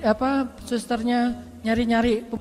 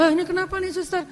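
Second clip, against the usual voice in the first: high surprised question to the nurse; a startled patient asking what's wrong; kaget bertanya; 6 semitones higher than usual. Retold or imitated. (pasien kaget bertanya ke suster)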